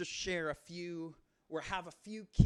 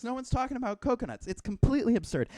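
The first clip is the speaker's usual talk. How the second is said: usual speaking voice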